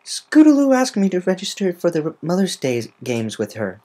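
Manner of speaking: put-on a worried young pegasus telling the news; Rainbow Dash voice. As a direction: Rainbow Dash, high and anxious.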